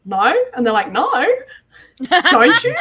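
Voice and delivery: "don't you" swung sharply up at the end; rising inflection. Retold or imitated. Friends teasing her Australian-sounding speech, exaggerated rising inflection. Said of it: "my inflection goes up at the end".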